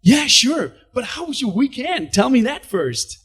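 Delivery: high, chirpy teller voice, slowing at the end; pry cheerfully for small talk; eager bank teller. Retold or imitated. Teller stalling the customer with chatty high cheer.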